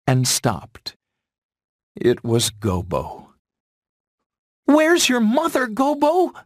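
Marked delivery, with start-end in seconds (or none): 4.7-6.3 very high-pitched, loud fawn voice; young fawn Bambi. A narrator's reading. Bambi to stranded Gobo about his mother, high urgent asking. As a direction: call out the question in a high little voice. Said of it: "Bambi asked".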